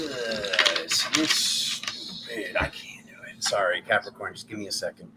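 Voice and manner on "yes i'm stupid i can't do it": unvoiced, slow whisper to herself; whispering in frustration after failing the game; whispered self-scolding. Real, not acted. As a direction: hush it, down on yourself. Muttering self-blame during game.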